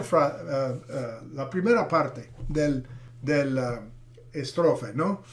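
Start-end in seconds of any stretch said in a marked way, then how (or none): none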